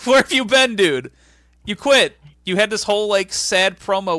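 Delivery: loud, high, exasperated demand; mock incredulous outburst. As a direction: call him out in disbelief. Acting out disbelief at wrestler's return.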